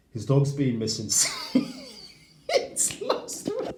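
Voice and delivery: high squeaky giggle breaking through the words; can barely get it out for laughing. Real, not acted. Giggling over neighbour's dog accusation.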